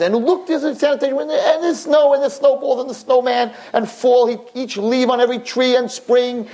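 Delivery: high-pitched, breathless-style list of sights; animated retold window report; excited commentary. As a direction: gush over each new sight, voice held high.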